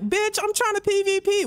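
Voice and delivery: high, huffy protest; mock indignant gamer. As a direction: play an offended player snapping back. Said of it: "You're like".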